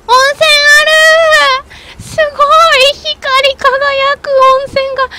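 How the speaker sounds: high, loud, drawn-out delight. Real, not acted. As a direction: marvel at the shining hot spring. Finds a glowing hot spring.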